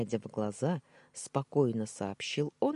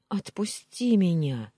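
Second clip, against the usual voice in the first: pleading cry; 5 semitones higher, 6 dB louder than usual. Acted. Heroine begs captor to release her.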